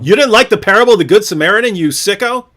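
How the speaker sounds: joking indignant jab at a friend; mock outrage. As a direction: pretend to be scandalized by your friend.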